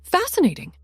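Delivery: high, loud, delighted exclaim. A narrator's reading. Marie Antoinette exclaims over the maid's tale, delighted. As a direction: gasp it out in delight. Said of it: "exclaimed a nearby Marie Antoinette".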